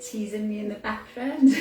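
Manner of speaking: laughing. Real, not acted. Teased by someone in the background, laughing. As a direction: rush it out through a laugh. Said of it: "I'm laughing because".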